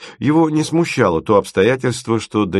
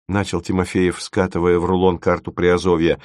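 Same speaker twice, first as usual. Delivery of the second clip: ordinary delivery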